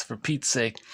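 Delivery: ordinary delivery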